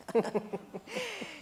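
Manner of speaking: high teasing giggle; a playful giggle after praising his looks. Acted. Teasing her friend about Mike, high giggle. Gossiping about a handsome man.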